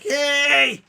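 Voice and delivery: hammed-up golfer's yell; old man yelling. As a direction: shout him off the course.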